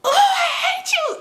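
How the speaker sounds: very high, slow mock scream; imitating a drawn-out, high-pitched wail; retold screaming. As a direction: screech it like a distraught friend. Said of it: "hear her screaming this all night".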